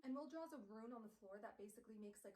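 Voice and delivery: ordinary delivery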